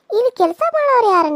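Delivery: high and loud jeer; loud high mocking shout; taunting shout. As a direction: yell the taunt. Rival taunts loudly.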